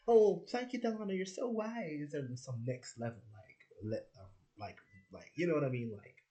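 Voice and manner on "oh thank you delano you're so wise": high, loud sarcastic thank-you; squealing mock praise; mock gratitude. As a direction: gush fake thanks way up high. Mock grateful friends quoted.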